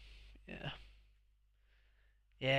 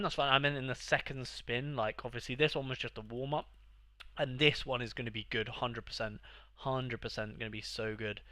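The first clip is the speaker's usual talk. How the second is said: ordinary talk, nothing marked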